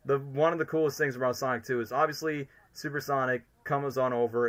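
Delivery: ordinary delivery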